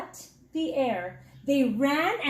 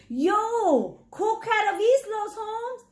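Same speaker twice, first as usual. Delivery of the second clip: high, lightly voiced cool cat purring a greeting; 8 semitones higher, slower than usual. A narrator's reading. Novio Boy purring hello to his best friend Chato. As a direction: play it smooth and purring, pitched up. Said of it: "he purred".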